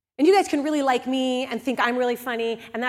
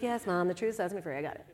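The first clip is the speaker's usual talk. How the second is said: softer, brushing-off reply to mom; dismissive to mom; 5 semitones lower, 9 dB quieter than usual. Retold or imitated. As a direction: wave mom off, half laughing.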